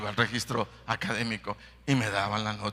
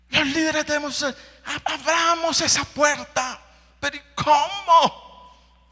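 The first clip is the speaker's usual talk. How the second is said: shouted exhortation; 5 dB louder than usual. Real, not acted. Preacher calls the leaders to open the door, shouting. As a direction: cry out to the leaders.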